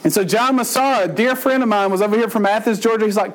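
normal talking voice

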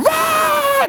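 very high engine scream; piercing drawn-out motor wail; imitated screaming chainsaw. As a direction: mimic a chainsaw at full whine. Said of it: "that thing started screaming".

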